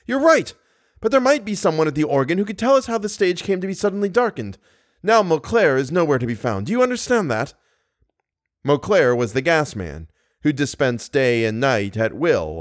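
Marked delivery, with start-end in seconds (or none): 0.1-7.5 high-pitched, harried pleading; a flustered man near breaking point; stage manager pressing Mercier, frantic and high. Acted. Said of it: "frantically pushing his hands".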